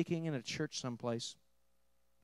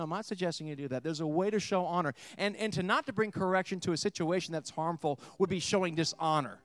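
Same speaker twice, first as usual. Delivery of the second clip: ordinary talk, nothing marked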